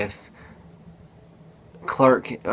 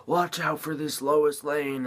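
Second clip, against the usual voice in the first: higher, drawn-out mock warning voice; urgent warning; 5 semitones higher, 6 dB quieter, slower than usual. Movie visitor grabbing Bruce Wayne, urgent warning. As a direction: imitate a stretched-out cry of warning. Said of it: "grabs Bruce Wayne and is like".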